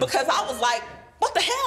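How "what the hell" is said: sudden high-pitched what the hell; exasperated outburst. Retold or imitated. Wife replaying her blow-up at husband, high and indignant. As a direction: jump way up in outraged disbelief.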